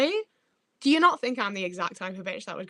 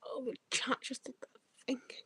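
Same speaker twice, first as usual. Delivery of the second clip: soft whispered aside; hushed, voiceless remark; 4 semitones higher, 9 dB quieter than usual. Real, not acted. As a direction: whisper it under your breath to the viewers. Whispered aside about the chat.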